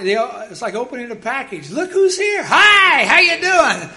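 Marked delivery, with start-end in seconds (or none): 1.7-3.9 loud, high, beaming hello to a stranger; cheerful doorstep greeting. Retold or imitated. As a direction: greet them like Christmas morning at the door.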